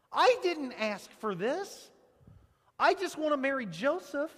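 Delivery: put-on loud, high protest, like a girl overwhelmed by news; exasperated teenage girl. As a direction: throw up your hands and push back loudly. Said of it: "you wanna say".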